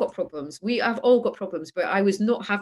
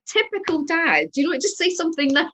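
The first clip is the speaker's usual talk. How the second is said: mock exasperated retort; 8 semitones higher, 3 dB louder than usual. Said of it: "I was like".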